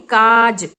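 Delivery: काज said slowly, the aa after क pulled long and loud; slow teacher's काज with a long open aa; drawn-out vowel. Shown as a demonstration. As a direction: stretch the aa in काज for the children. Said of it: "जो क है उसको हमने काफी खींच कर बोला".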